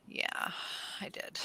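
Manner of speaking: quiet, halting confession; sheepish admission. Retold or imitated. Shaun admitting to his girlfriend he forgot everything, sheepish. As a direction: own up softly, with a pause after "yeah". Forgetful boyfriend owns up when caught.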